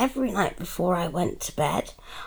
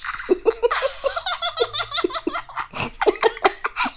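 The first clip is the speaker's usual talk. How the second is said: high laugh. Acted. Mother laughs at daughter.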